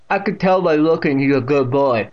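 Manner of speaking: loud deaf great-aunt's voice; Catherine. A narrator's reading. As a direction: speak out distinctly as the deaf aunt. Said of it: "with that distinct pronunciation".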